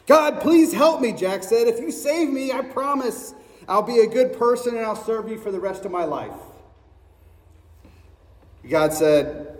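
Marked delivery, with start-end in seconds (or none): 0.1-6.3 high, rushed begging; pleading Jack. Acted. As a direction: bargain desperately for rescue. Jack begs God.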